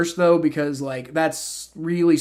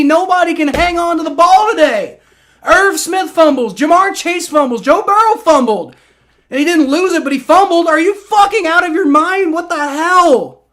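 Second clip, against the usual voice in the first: loud, high, fed-up ranting; exasperated rant; 11 semitones higher, 8 dB louder than usual. Real, not acted. Fan ranting at his team's fumbles, loud exasperation. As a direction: list every fumble in outrage.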